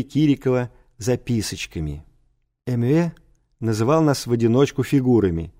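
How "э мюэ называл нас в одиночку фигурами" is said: usual speaking voice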